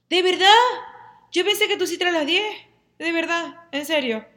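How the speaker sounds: high, loud, mock-innocent astonishment; fake surprise. Friend pretends she forgot the date time, mock surprised. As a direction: play innocent, wide-eyed.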